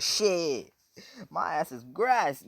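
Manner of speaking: high, loud cry of dread; hammed-up doomed yelp, drawn out; mock panic. Scared son threatened with a beating by mom, loud mock panic.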